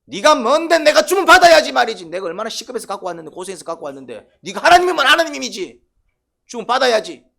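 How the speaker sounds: high, very loud outraged rant; defiant, angry tirade. Acted. Cain railing at God over his offering, defiant shouting. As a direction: hurl the grievance at full volume. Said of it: "이런 태도다 이 말이죠".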